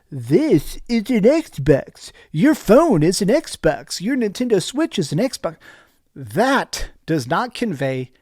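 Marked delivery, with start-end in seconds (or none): 0.1-5.6 slow, sarcastic slogan read; mocking ad voice. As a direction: parody a cheesy ad tagline.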